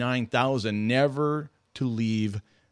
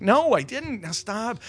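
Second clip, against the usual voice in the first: much higher, indignant no I didn't; mock indignant denial; 11 semitones higher, slower than usual. Dad denying to his family that he said it: high, defensive mock denial.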